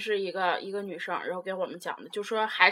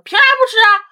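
much higher and louder indignant snap; imitating the boy flaring up over mooncakes; mock angry outburst; 12 semitones higher, 12 dB louder, faster than usual. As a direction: burst out like a sulking boy. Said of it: "那个男生就急眼说".

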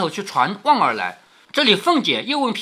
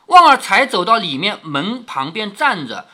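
ordinary talk, nothing marked

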